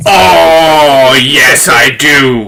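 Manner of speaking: loud, drawn-out delighted yes; excited recognition. Real, not acted. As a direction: burst in eagerly, stretching the words. Eagerly recognises the bird sound described.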